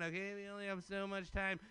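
ordinary talk

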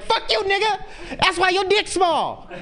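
high, cackling trash talk; girl with a shield mocks whoever hits her; laughing sassy taunt. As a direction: taunt with a laugh like an untouchable kid.

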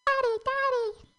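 very high, drawn-out squeal for dad; mock terrified child. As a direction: call out like a scared little kid, hammed-up. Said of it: "I called out for my dad in terror".

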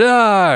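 a loud, high mock bear roar. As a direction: let out a hammed-up beast yell. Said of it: "And the bear said".

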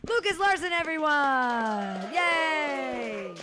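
loud, drawn-out cheer for the act; MC shout-out. Real, not acted. Host cheering off the comedian to the crowd, loud MC shout-out.

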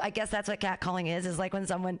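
ordinary talk, nothing marked

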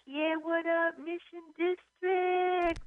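high, slow hype-man call; mock MC shout-out. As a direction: play to the crowd like a stage host. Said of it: "I'd be like".

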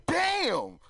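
high, drawn-out appreciative exclamation; wowed by how fine she is; mock-awed catcall; impressed high "damn". Ogling a fine woman.

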